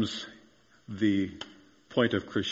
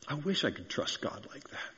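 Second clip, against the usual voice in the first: soft, higher, longing sigh of a wish; wistful; 5 semitones higher, 9 dB quieter than usual. Retold or imitated. A doubting listener wishing quietly, soft and wistful. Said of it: "hearing this somewhat wishfully".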